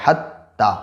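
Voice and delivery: हत्ता with the त doubled and held; sit on the double त in हत्ता; doubled t demo. Shown as a demonstration. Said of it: "जैसे". (तशदीद के साथ हत्ता)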